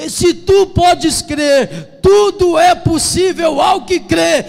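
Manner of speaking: high, shouted exhortation; roaring pulpit cry; full-force preaching. Real, not acted. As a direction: thunder it at the congregation.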